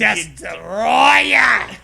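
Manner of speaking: slow stretched monster-name yell; drawn-out shout. Pretend. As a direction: announce the monster like a wrestler.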